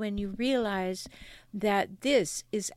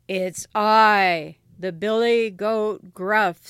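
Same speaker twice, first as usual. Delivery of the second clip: loud, slow big billy goat; ugly, hoarse voice; 8 dB louder, slower than usual. Acted. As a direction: announce yourself as a huge hoarse goat. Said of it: "who had an ugly, hoarse voice".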